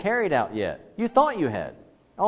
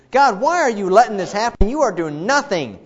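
loud, higher protest at God; Habakkuk's loud complaint; 9 semitones higher, 7 dB louder than usual. Retold or imitated. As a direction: cry out the accusation. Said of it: "and he says".

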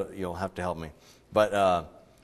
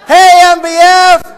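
loud, high, slow cheer breaking into laughter; pep rally cheer; 20 dB louder, slower than usual. Pretend. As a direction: shout it like a store rally. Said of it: "we might try that this morning".

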